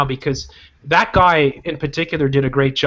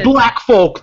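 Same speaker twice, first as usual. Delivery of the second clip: emphatic; 8 semitones higher, 6 dB louder than usual. Real, not acted. Heated rant about community leaders.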